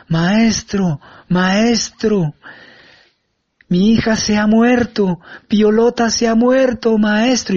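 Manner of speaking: retelling the wail, a slow, pleading cry; desperate wailing shout. Retold or imitated. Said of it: "llega gritando a voz en cuello, desesperada".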